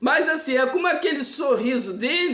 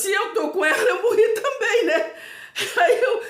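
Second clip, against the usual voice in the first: giggling through the words, higher; amused disbelief at her own story; laughing while telling; 6 semitones higher than usual. Real, not acted. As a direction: laugh as you tell the strange part. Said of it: "eu tô contando assim com graça".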